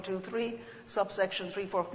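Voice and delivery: ordinary delivery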